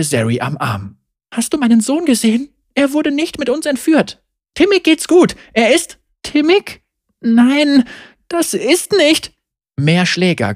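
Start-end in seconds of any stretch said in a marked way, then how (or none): 1.3-6.0 high, fast and urgent; frantic mother. Acted. A mother grabs Zeri's arm, asking high and hurried. 6.2-9.3 high, unsure, slowing at the end; confused young heroine. Acted. Zeri answers the worried mother, high and hesitant.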